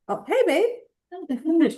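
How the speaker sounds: slow, high, tender hello; a drawn-out sweet greeting to a little one. Real, not acted. Child walks in mid-podcast.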